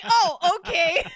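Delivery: loud, high, laughing "oh, okay"; retold startled agreement, breaking into a laugh; amused surprise. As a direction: go along with it, loudly taken aback. Startled reply to odd fan request.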